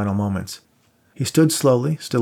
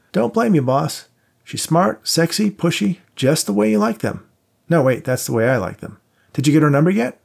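a hint of a higher, teasing pal; lightly voiced buddy; 7 semitones higher, 4 dB louder than usual. A narrator's reading. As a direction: play the cocky friend lightly. Voiced friend Max.

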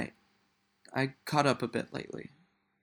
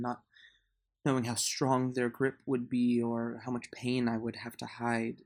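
usual speaking voice

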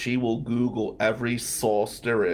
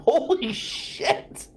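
disbelief; 13 semitones higher, much slower than usual. Real, not acted. Shocked by woman's remark.

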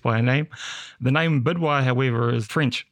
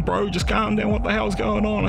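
urging calm; 10 semitones higher than usual. Retold or imitated. Mate calming him.

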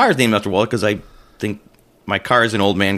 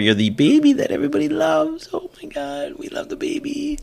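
put-on very high, gushing baby-talk voice; exaggerated high-pitched fawning; mock doting relative. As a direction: coo over the baby in falsetto. Mimics family doting on the baby.

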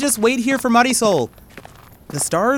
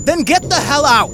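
heated, raised voice throwing her out; angry retort; 5 semitones higher, 4 dB louder than usual. Acted. Wes to Lily in a fight, angry. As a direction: snap back in anger.

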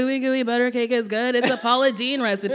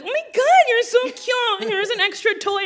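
gushing drive-thru worker; 13 semitones higher than usual. Retold or imitated. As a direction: squeal it like a charmed cashier. Worker gushing back at child.

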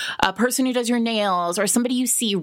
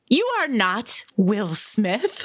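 mayor's scolding; 2 semitones higher than usual. Retold or imitated. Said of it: "The translation is".

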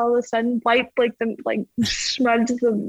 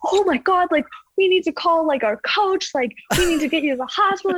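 higher-pitched retold panic; imitating freaked-out teammate; 7 semitones higher than usual. Teammate urging the injured athlete to hospital, retold freak-out. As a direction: act out a friend freaking out, pitched up. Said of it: "she's, like, freaking out".

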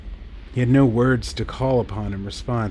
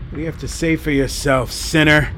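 rising to a loud, higher accusing snarl; 6 semitones higher, 4 dB louder than usual. A narrator's reading. Priest turning on Paul, snarling. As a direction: spit the word sinner at him. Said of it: "the priest snarled".

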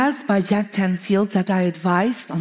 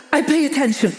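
eager, higher and bright with excitement; enthusiastic; 5 semitones higher, faster than usual. Retold or imitated. Answering Rhonda from Australia, eager and excited. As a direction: blurt it out eagerly. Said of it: "You're so enthusiastic".

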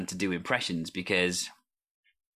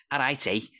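Scouse accent. Shown as a demonstration. Host demonstrates his starter phrase, Scouse accent. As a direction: launch into a Liverpool Scouse voice. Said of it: "If I'm gonna do Scouse".

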